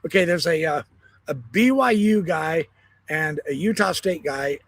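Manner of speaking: normal talking voice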